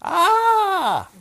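put-on a short, loud, high baby's cry. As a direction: cry out like a baby wanting something. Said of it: "What does a baby do first to communicate its wants?".

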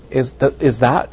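ordinary talk

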